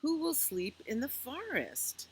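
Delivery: gentle, lilting picture-book read-aloud; reading to children. A narrator's reading. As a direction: read it slowly to little ones at bedtime.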